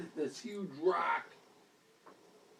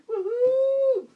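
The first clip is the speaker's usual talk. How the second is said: high, loud whoop; gleeful high "woohoo"; penguin cheer; 10 dB louder than usual. Acted. Penguin cheers while jumping in.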